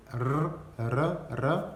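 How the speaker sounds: repeated trilled "r"; tongue-rolled R. Shown as a demonstration. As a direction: roll each "r" with the tongue. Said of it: "c'est la langue qui fait ça". (« r » roulé avec la langue)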